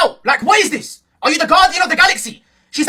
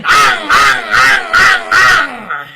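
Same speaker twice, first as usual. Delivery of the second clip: loud repeated comic moans; mock moaning; 4 semitones lower, 5 dB louder, much slower than usual. Joking about a future love, loud mock moaning. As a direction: grunt out exaggerated pretend moans. Imitates sex noises as a joke.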